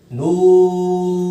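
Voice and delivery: teacher models a long noon; elongated letter name. Shown as a demonstration. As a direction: stretch नून long and level. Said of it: "पाँच अलिफ के बराबर ही हमने तकरीबन खींचना है".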